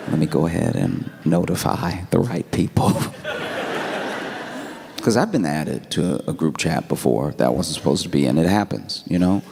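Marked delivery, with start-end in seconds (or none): 3.0-4.7 soft airy chuckle; chuckling quietly at the joke; breathy laugh. Real, not acted. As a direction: laugh under your breath. Laughs at own story.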